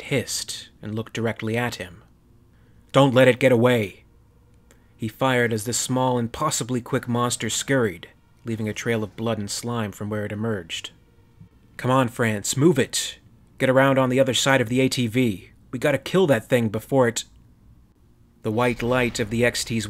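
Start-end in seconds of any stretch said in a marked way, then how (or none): 2.9-4.0 shouting. Acted. Firefight, soldiers yelling orders. 11.8-17.2 urgent yelling. Acted. Urgent orders under fire.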